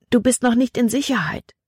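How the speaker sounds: high-pitched warning call. A narrator's reading. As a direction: warn a friend, voice lifted high. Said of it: "hätte Libby am liebsten gerufen". (hoher, warnender Ruf)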